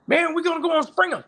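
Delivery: high, fast, excited friend. Retold or imitated. Friend phoning with big news, high and excited. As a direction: blurt it out like a thrilled buddy. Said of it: "very excited".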